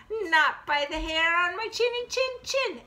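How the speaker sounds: high little pig voice, climbing in pitch; defiant little piggy. Acted. Piggy refuses the wolf at the door, high sing-song. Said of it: "And the piggy says".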